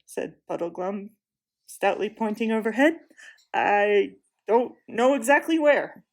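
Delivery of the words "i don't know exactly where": put-on slow, louder dogged old creature; stout Marsh-wiggle. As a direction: plant your feet and answer stubbornly. Puddleglum voiced.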